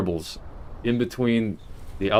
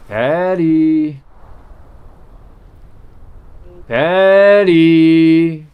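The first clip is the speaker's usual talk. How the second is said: loud, high call across the room; calling out loudly; 8 semitones higher, 11 dB louder, much slower than usual. Real, not acted. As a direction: shout her name, building. Calling mother, louder each time.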